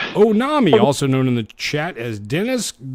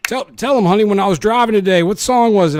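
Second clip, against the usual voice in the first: excited; 8 semitones higher, 7 dB louder than usual. Real, not acted. Excited talk to partner.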